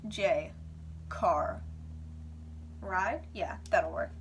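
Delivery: low, halting one-word thoughts; dopey low inner voice, pauses between words; dim-witted thinking. Retold or imitated. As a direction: imitate a slow brain piecing a plan together. Mimics own dumb drugged thought process.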